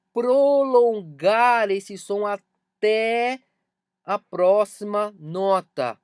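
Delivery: very slow, stretched vowels, emphatic; slow, held syllables with firm projection; drawn out. Shown as a demonstration. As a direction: stretch the words to illustrate a prolonged sound. Acts out prolonging the sound.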